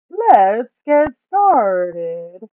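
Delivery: high, loud, slow put-on voice for the greedy Duke. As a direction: drag the words out, high and loud, savouring the loot. Duke keen to loot.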